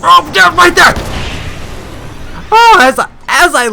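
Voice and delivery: high loud excited shout; excited yelling. Real, not acted. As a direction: cheer at the perfect moment. Boss moves at the right moment.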